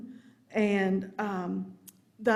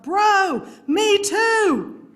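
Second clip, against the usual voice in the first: exasperated full-voiced yell, much higher and louder; yelling at laptop; 12 semitones higher, 10 dB louder, much slower than usual. Speaker to laptop stuck on connecting, exasperated yell. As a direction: holler it at the stubborn machine. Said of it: "yelling at the laptop".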